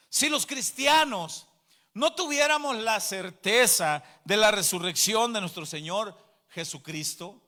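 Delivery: high, loud proclamation in weighty phrases; full-force preaching. Real, not acted. Preacher to congregation on certainty of resurrection, high impassioned proclamation. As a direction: proclaim it loudly, leaning on each phrase.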